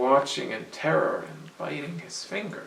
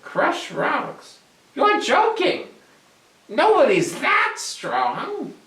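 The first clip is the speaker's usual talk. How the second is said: high, loud goblin disbelief; a tiny goblin mocking the boast; scoffing goblin; 13 semitones higher, 7 dB louder, slower than usual.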